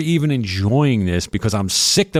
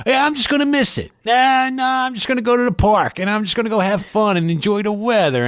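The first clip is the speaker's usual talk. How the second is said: much higher, airy fake-casual voice; mock carefree; 11 semitones higher than usual. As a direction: pretend you don't care, pitched way up. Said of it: "and I'm like".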